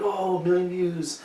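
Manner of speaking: soft, drawn-out mock awe; pretend marveling. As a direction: marvel slowly at an imagined windfall, softly. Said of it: "then I'll be like".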